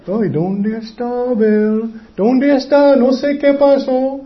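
high, drawn-out, wailing helplessness; slow sing-song pretend bewilderment; mock clueless worry. Preacher mocking the idea of God not knowing where Abel is, mock worried wail. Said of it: "Dios no era".

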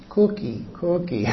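cookie dicho muy suave, como niña; susúrralo como una niña tímida; niña bajito. Retold or imitated. (niña diciendo cookie bajito a su mamá)

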